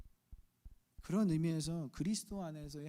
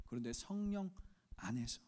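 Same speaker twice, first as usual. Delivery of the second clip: ordinary talk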